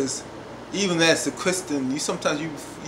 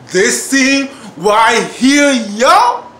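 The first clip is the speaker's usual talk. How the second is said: loud, slow, raised-pitch build; emphatic hype; 6 semitones higher, 11 dB louder, much slower than usual. Real, not acted. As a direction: stretch each word out loud, rising on the last. Hyping up the scene, repeated.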